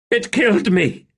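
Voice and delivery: high, pained voice; Rulad. A narrator's reading. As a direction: cry it out in anguish. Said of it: "Rulad in anguish".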